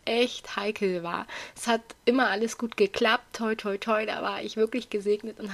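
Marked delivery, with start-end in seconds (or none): none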